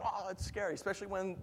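ordinary talk, nothing marked

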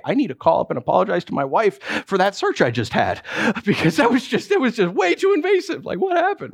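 high-pitched, flustered comic disbelief; mock appalled. Traveler reeling from an invasive security search, mock appalled. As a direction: laugh nervously, then go incredulously high.

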